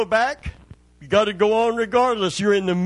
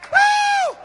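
very high shouted woo; ecstatic whoop; 4 dB louder than usual. Real, not acted. Preacher whooping at the sermon's peak, a high joyful cry.

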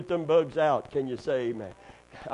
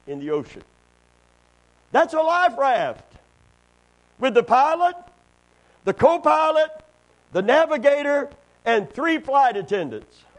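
a hint of a calm pilot over the intercom, rising and louder; 10 semitones higher, 7 dB louder than usual. Preacher telling the joke, hamming the pilot's announcement. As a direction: play the captain announcing disaster lightly. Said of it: "the pilot's calm voice came over the intercom".

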